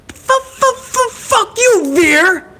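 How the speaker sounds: very high falsetto, repeatedly stuck on the first sound; hammed-up high sputtering retort; squeaky mock stutter. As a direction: stutter the comeback in a silly squeal. Quarrel escalates into stuttering squeal.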